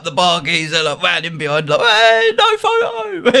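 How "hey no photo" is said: very high mock yell; imitated high-pitched protest; retold shout. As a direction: holler it like someone calling across a bar. Retold shout over photo ban.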